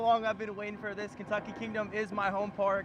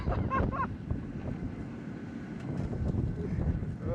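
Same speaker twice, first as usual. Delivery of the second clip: breathless laughter. Real, not acted. Laughing on ride.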